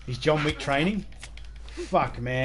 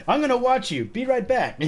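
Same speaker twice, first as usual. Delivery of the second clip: high, sing-song mock sweetness; passive-aggressive; 10 semitones higher, 5 dB louder than usual. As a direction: sneer it in a sugary high voice. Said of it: "kinda like a passive-aggressive message".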